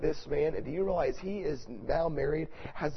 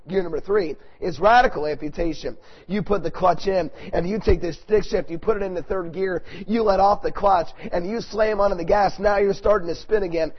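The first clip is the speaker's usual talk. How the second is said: loud, raised, driving sermon delivery; full-force preaching; 4 semitones higher, 9 dB louder than usual. Real, not acted. Preacher urging radical action against sin, loud forceful preaching. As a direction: drive each phrase home to the congregation.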